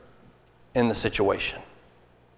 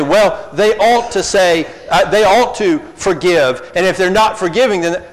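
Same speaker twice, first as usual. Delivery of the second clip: loud, raised objecting voice; mock objector; 6 semitones higher, 7 dB louder than usual. Preacher voicing an indignant congregant, loud and raised. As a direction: argue back like an indignant listener. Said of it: "And you might say".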